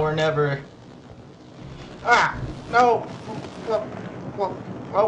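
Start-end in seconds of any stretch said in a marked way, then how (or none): 2.0-3.1 pained yelp. Real, not acted. Player hit by hazard.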